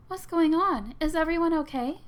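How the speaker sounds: high, loud worried voice; alarmed character voice. Acted. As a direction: rush in, alarmed. Rosalina rushes in.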